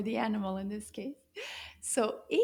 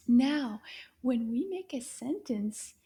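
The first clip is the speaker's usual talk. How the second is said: usual speaking voice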